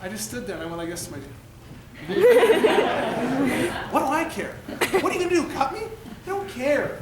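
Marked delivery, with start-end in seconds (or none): 1.4-3.9 laugh. Retold or imitated. Laughing back at his dad. 3.9-7.0 higher, laughing it out, carefree. Retold or imitated. Player laughing to his dad, finally carefree. Carefree, sent in despite injury.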